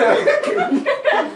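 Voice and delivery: very high giggle; cracking up; high laugh. Real, not acted. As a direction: squeal of laughter. Laughs at script.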